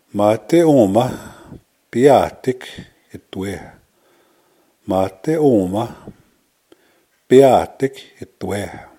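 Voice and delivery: slow, phrase broken into separate chunks; careful, spaced-out language-lesson delivery; slow teaching demo. Shown as a demonstration. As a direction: model it slowly for new learners. Teacher models phrase slowly for learners.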